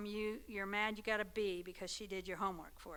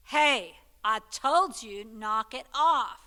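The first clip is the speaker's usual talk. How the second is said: slow, much louder warning from an annoyed father; dad yelling at the kids to knock it off; loud stern dad; 12 dB louder, slower than usual. Retold or imitated. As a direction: bark it out like a fed-up dad. Dad's second, angrier warning.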